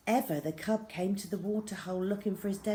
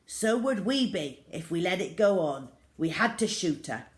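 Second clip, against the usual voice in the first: louder, firm dismissive father; curt retort; 6 dB louder than usual. A narrator's reading. Father shuts down the mother's worry with a loud retort. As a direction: cut her off hard. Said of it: "his father retorted".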